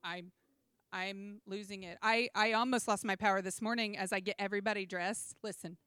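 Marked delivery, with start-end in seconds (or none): 0.0-2.0 quiet muttering. Retold or imitated. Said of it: "You're like".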